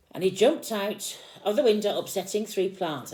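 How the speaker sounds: put-on lively read-aloud to a small child; storybook reading. A narrator's reading. Parent reading Peter Rabbit to a child, lively storytelling. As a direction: read the picture book out with energy.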